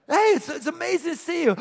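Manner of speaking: high gushing delight; thrilled greeting. Shown as a demonstration. Greeting a neighbor warmly, high gushing delight. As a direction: gush with delight at seeing them. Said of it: "That's honor".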